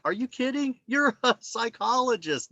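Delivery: can't believe she's asking; amazed, as if it's obvious; incredulous. Retold or imitated. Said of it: "And I'm like".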